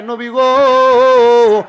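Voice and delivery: loud, long-held cry to the prophet; long drawn-out plaintive call. Acted. Bird brings its complaint to the prophet, drawn-out call. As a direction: stretch the last word into a loud appeal. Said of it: "পাখি আইসা সুলাইমান নবীর কাছে বিচার".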